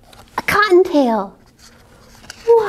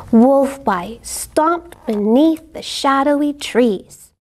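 usual speaking voice

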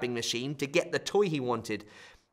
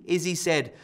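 plain reading voice

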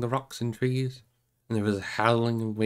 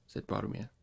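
ordinary delivery, nothing marked

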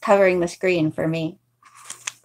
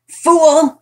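scornful; 7 semitones higher, 7 dB louder than usual. Acted. Trapper scoffs at fleeing woman.

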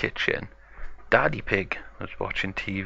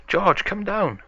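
high-pitched storybook call; mild calling shout; 11 semitones higher than usual. A narrator's reading. Peppa calls George down from his room, mildly shouting. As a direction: call upstairs in a high voice, not a full yell. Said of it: "So Peppa shouts to George".

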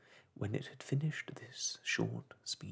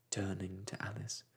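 ordinary delivery

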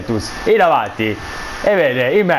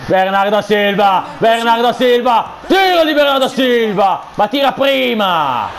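commentator shouting; 7 semitones higher than usual. Real, not acted. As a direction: call the shot like live TV. Live play-by-play shouting. (telecronaca urlata)

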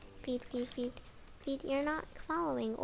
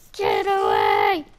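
much lower, louder, slow growl; monster growl; 11 dB louder, slower than usual. Pretend. Scaring off mobs, a slow louder growl.

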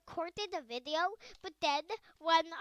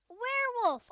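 ordinary delivery

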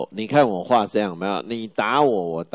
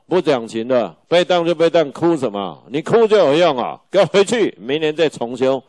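much higher and louder mock tirade at a crying student; stern professor scolding; 6 semitones higher, 6 dB louder than usual. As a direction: bark it out like a fed-up professor. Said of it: "教授说：".

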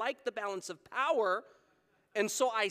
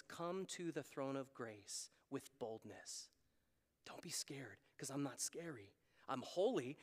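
gentle divine reassurance; 9 semitones lower, 14 dB quieter than usual. Acted. Preacher voicing God telling people not to be scared, tenderly hushed. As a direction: hush it right down to reassure the fearful.

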